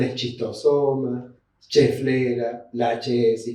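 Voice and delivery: "eschistosoma, cheflera" slowly with Spanish CH; slow list of wrong CH sounds; CH mispronunciation. Shown as a demonstration. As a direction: say each CH as in "chico". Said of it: "Muchas veces dicen".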